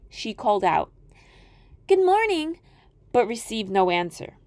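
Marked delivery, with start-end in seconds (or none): 1.9-2.6 put-on high, worried girl voice; a child sensing something wrong; uneasy little girl. Girl calls out.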